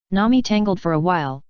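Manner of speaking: ordinary delivery, nothing marked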